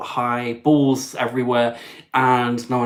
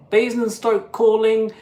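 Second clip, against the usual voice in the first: Eurovision caller; 10 semitones higher, 3 dB louder than usual. Shown as a demonstration. Host showing viewers how to call in, Eurovision style. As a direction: announce it like a Eurovision jury calling in. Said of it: "in true kind of Eurovision style".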